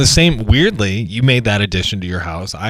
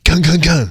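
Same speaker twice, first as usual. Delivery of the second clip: higher-pitched knock-knock sound effect; vocal knocking, three even beats; imitated knocking; 7 semitones higher than usual. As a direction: mimic three raps on a window. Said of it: "I'd knock on, like, a window".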